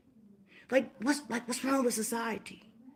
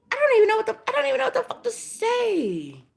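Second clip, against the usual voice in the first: much higher and louder, at a loss; exasperated outburst; 11 semitones higher, 8 dB louder, faster than usual. Real, not acted. Venting to viewers about cruelty, exasperated shout. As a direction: blow up in disbelief.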